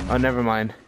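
ordinary talk

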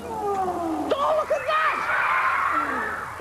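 high, drawn-out astonished shout; amazed shout. Real, not acted. [name] sees the kitten has become a lion, astonished shout. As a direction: stretch it out, truly gobsmacked.

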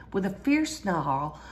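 usual speaking voice

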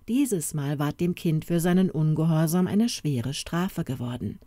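usual speaking voice

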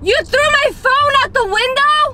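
high, stunned accusation; shocked and furious; outraged disbelief. Real, not acted. Phone thrown out window.

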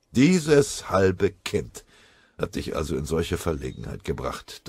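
ordinary talk, nothing marked

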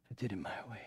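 a hushed, voiceless whisper of defeat; whispered regret. Retold or imitated. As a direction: breathe it out as if ashamed to say it. Blind Samson muttering to himself.

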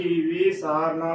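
ਵੀ ਸਾਰਨਾ opening on "vee"; wrong long-i reading. Shown as a demonstration. Teacher showing the wrong reading, ਵੀ ਸਾਰਨਾ with long "vee". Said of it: "ਨਾ ਕਿ".

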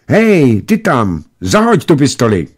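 put-on high, loud boyish shout; shouted order. A narrator's reading. Boys order the pilot to drop his pistol, shouting. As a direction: call out the command from a distance. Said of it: "křikli na něho".

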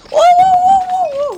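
slow, high and loud drawn-out oh's; mock alarm. Gamer startled by a jolt, slow high alarmed oh's. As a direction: let the oh's climb in mock fright. Quake jolts the game building.

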